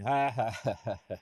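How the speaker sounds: mock group chuckle, dying away; imitated chuckling. Trainer imitates everybody else in the meeting, mock chuckling. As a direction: imitate a room chuckling along.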